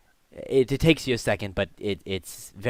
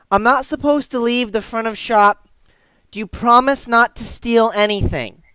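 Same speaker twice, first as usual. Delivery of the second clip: put-on high, loud, earnest little boy; earnest young boy; 12 semitones higher, 10 dB louder than usual. Boy asking stranger not to steal, earnest and young. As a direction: ask it with a kid's seriousness.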